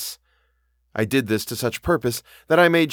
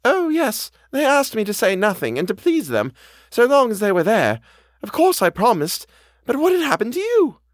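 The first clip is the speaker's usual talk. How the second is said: high-pitched, earnest housekeeper voice; anxious housekeeper; 13 semitones higher, 4 dB louder than usual. A narrator's reading. Housekeeper explains her promise to the governess, high and anxious. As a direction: pitch it well up, a worried servant explaining herself.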